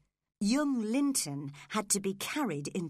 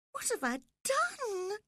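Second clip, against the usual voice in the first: sobbed; 13 semitones higher than usual. Acted. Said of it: "she sobbed, suddenly frightened".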